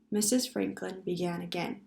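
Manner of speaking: ordinary delivery, nothing marked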